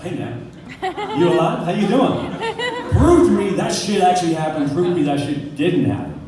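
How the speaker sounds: loud, demanding challenge to yourself; mock self-interview. Man interviewing himself in the mirror, loudly mock-demanding. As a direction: start casual, then loudly demand proof. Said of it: "interview myself".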